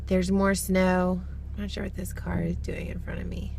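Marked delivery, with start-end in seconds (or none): 1.5-3.5 a hushed, breathy aside to the camera; whisper. Real, not acted. Whispering to viewers while stuck behind a car. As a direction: half-whisper a comment about the car ahead.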